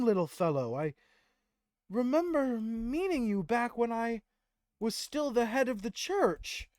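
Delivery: high, loud, grand character voice; Tyrael recognizing him. Acted. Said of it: "As Tyrael looks to him and says".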